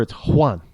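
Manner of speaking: Juan with a breathy H, "HWAN"; Spanish pronunciation. Shown as a demonstration. Explaining names from south of the border, Spanish-style Juan. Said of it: "if you're coming from south of the border".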